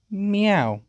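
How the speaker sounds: spoken meow as a cat. Acted. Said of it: "I guess I will say".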